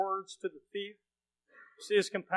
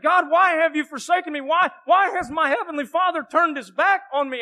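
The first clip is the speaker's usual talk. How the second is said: a son crying out to a Father who turned away; loud anguished cry; 9 semitones higher, 11 dB louder than usual. Acted. Preacher voicing Jesus crying out loud from the cross. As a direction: cry it out loud and high, pleading. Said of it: "Jesus cried out in a loud voice".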